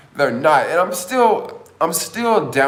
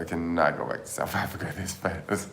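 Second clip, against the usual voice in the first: laughing; 4 semitones lower, 8 dB quieter than usual. Real, not acted. Laughs at own remark.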